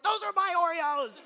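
put-on high, loud outraged child; squeaky upset boy voice; indignant kid. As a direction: protest like a wronged little boy. Son indignant about his Oreos.

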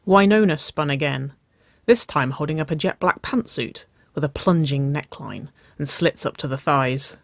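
plain reading voice

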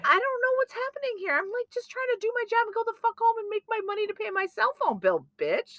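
high, fast, heated rant; retold fury. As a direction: rattle off the anger at the chef. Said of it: "Like, I was so mad".

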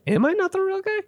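very high-pitched, squeaky disbelief; doubting himself, voice shooting way up; self-doubting question. Acted. Acting out his growing self-doubt.

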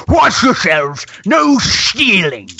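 high-pitched mock bellow, words drawn out; bellowing brute. Hulking market guard warning the group off stealing, bellowing. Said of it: "bellowing at you".

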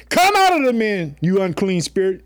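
high, loud command to cast out the spirit; commanding shout. Acted. Jesus commanding the unclean spirit, loud and high. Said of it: "It says this".

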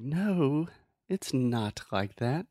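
"not" with a crisp, released T, a touch dramatic; over-pronounced T. Shown as a demonstration. Teacher exaggerating the T for the student. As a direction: clip the T on "not" hard. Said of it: "with the T and everything".

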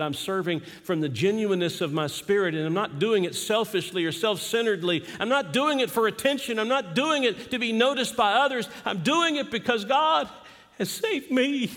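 rising preacher's fervor; preaching. Acted. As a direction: build it up like a preacher. Preaching builds to a climax.

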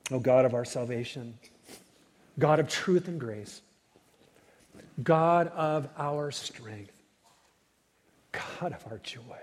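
slow, loud fervent prayer. Real, not acted. Pastor leading the church in prayer, fervent. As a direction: pray out with weight on each God.

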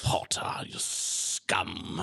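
contemptuous whispered insult; whispered sneer. Retold or imitated. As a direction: hiss it with disdain. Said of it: "Xaden's sort of Potter".

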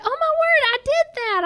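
a high giddy oh my word; surprised squeal. Retold or imitated. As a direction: gasp with sudden recognition. Excited surprise at coincidence.

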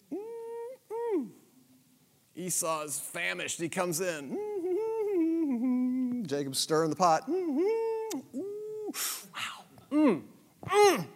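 high hummed mm of delight; playful high yummy hums; mock savoring. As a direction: hum like smelling good stew. Imitating the smell of stew.

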